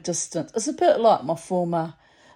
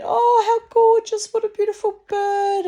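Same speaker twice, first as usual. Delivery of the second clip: gushing lady; 8 dB louder than usual. Retold or imitated. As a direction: gush like a delighted stranger. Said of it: "she said".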